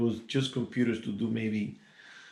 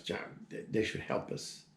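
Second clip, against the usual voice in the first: mumbled under her breath, almost whispered; mumbling; 9 dB quieter than usual. Retold or imitated. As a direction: mutter it to yourself, barely voiced. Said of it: "she starts mumbling".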